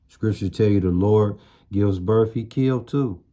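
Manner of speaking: normal talking voice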